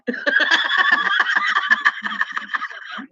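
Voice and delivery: a voiceless, airy burst of laughter; breathy high laugh. Real, not acted. Laughing at his own Big Crack joke.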